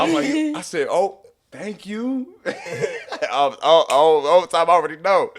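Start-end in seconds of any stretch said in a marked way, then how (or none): 0.9-2.4 slow, high mock gratitude; hammed-up drawn-out thanks; acting surprised. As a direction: feign surprise, pitched way up. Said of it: "I'm acting surprised".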